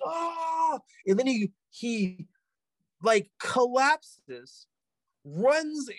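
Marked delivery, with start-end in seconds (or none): 0.0-0.8 high, drawn-out mock wail; high held-back "aah"; imitated scream. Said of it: "He's flailing around like".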